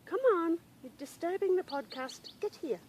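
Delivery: high-pitched coaxing reprimand; scolding the dog. Real, not acted. Podcaster to dog disturbing the podcast, scolding call. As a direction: chide a pet while calling it over.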